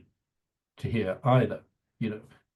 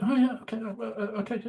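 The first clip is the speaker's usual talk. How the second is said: higher, mock flustered stammer; a retold flustered young reporter, pitched up; 11 semitones higher, 4 dB quieter than usual. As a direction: imitate her getting all flustered. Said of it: "she got all flustered".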